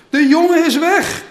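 loud, high alarmed cry; uitroep. A narrator's reading. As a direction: call it out loudly to the brothers. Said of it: "riep hij". (luide, hoge uitroep)

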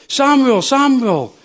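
high, loud call from afar; a loud, high voice calling through the night. Acted. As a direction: call the boy's name across the dark. God calls a fourth time.

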